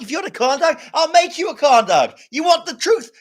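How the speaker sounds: high, loud hammed-up American accent; building to a mock movie-courtroom shout in American. Shown as a demonstration. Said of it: "do the accent".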